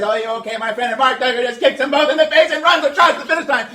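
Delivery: loud, high play-by-play; excited sportscaster voice; race commentary. Acted. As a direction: call the dance like a sports race.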